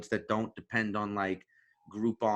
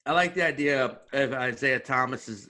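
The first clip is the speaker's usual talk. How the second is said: normal talking voice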